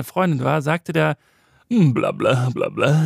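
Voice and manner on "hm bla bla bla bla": lightly mocked lower radio-man drone; a hint of a self-satisfied DJ, droning on; smug radio voice. Mocking the radio man who chats up his girlfriend, lightly lower. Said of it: "der sprach so".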